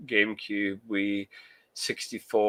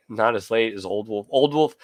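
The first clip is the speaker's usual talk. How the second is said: normal talking voice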